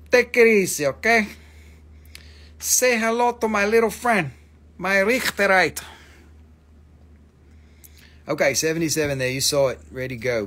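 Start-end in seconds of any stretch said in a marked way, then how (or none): 0.1-5.8 high, loud, hammed-up gangster line; mock movie tough guy. Seller introducing a stone as his little friend, mock movie tough guy. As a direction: ham up a film gangster's threat. Acts out a film catchphrase.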